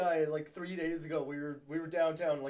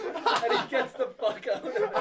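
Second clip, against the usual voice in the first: laughing; 8 semitones higher, 7 dB louder than usual. Real, not acted. Laughing while telling the ending.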